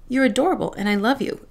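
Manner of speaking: ordinary delivery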